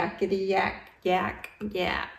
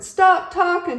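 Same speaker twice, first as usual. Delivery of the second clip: high, loud huffy quote; indignant quote; 12 semitones higher, 8 dB louder than usual. Retold or imitated. Yak repeats Camel's order, high and indignant. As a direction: mimic her rudeness indignantly. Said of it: "she said".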